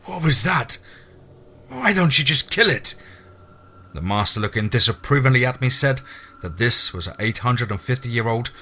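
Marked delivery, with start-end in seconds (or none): 0.0-2.9 high, distressed plea; almost crying. Acted. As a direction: question in shock, voice pitched up toward tears. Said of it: "almost crying".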